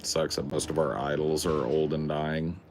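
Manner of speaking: usual speaking voice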